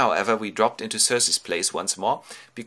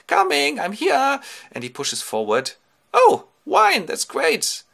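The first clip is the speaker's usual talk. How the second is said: very high, loud excited voice; eager old seer; 7 dB louder than usual. Acted. Tiresias pushes through the dead for wine, high eager voice. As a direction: rush in thrilled about wine.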